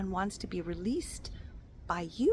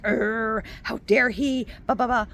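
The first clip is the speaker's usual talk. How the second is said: mock indignant rage; 2 semitones higher, 5 dB louder than usual. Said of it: "not through like".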